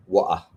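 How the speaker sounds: wa'ah with the t dropped to a glottal stop; northern UK wa'ah. Shown as a demonstration. Lecturer showing students northern English wa'ah. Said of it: "Wa'ah would be the northern U K side".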